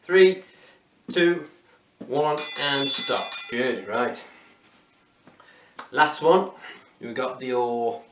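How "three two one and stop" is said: countdown call. Real, not acted. Coach counting down the seconds.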